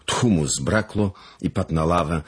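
plain reading voice

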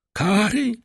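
high, startled echo; crying out. A narrator's reading. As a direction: cry the word back as a question. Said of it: "zawołała pani de Villefort". (wysoki okrzyk z pytaniem)

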